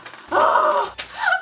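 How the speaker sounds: sharp high gasp of delight; high thrilled gasp; excited gasp. Real, not acted. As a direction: gasp loudly in surprise. Opening gift box, delighted.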